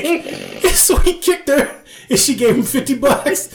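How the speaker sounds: high, slowed, laughter-filled telling; real laughing. Real, not acted. Telling co-host the absurd dojo sketch, laughing. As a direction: tell it while cracking up.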